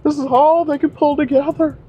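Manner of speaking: high falsetto whiny plea; mock crying agent. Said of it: "whole big sob story".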